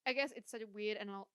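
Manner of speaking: ordinary talk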